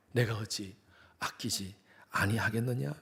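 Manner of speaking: God asking us again, soft and slow; God's question softly. Acted.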